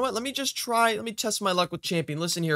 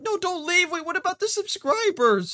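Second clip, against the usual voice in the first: high-pitched, urgent protest; alarmed pleading; 10 semitones higher than usual. Real, not acted. Player begs his quitting brother to stay, alarmed pleading.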